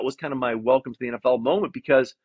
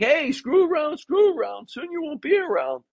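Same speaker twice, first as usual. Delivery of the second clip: much higher-pitched imitation of a joking coach; joking Marty Morningwig; 14 semitones higher than usual. Coach jokingly warning his players at the team meeting, high-pitched mock warning. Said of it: "Marty was joking".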